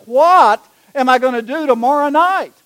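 high, loud wail of a question; acted-out frantic bishop; exasperated despair. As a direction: cry out as if the plan is ruined. Bishop despairs over lost keynote.

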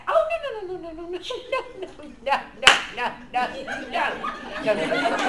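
high-pitched run of rapid noes; hammed-up string of noes, playfully; mock-polite protest. Shown as a demonstration. Teacher waving off the babysitter label, comic protest. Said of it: "You're just gonna politely go to them".

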